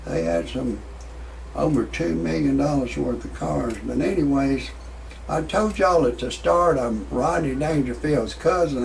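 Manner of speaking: ordinary delivery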